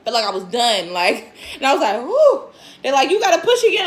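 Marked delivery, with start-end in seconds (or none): 2.0-2.5 a high loud exhale of relief; relieved whew. Retold or imitated. Relief after head out. 3.1-3.9 much higher and louder mock insistence; insisting. Staff voiced insisting.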